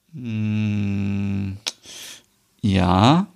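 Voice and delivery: halting, hesitant hm and yes; caught-out mumble; stammering. A narrator's reading. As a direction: falter out a reluctant admission. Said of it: "stammelt Stina".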